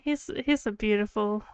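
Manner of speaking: normal talking voice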